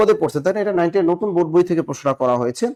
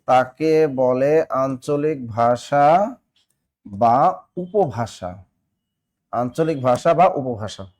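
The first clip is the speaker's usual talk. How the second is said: very slow drawn-out dictation; 3 semitones lower, much slower than usual. Shown as a demonstration. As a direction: stretch তাকে বলে আঞ্চলিক ভাষা বা উপভাষা out word by word, lower. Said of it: "মনে রাখবেন". (খুব ধীরে টেনে টেনে বলা)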